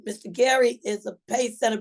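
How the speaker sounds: usual speaking voice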